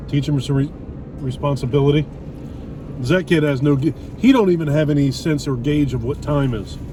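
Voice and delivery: usual speaking voice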